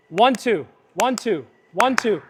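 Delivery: "one, two" in even paired beats; specific cadence. Shown as a demonstration. Coach demonstrating the clap cadence, "one, two" in rhythmic pairs. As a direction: count "one, two" as quick pairs, a small gap after each. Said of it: "it has a very specific cadence".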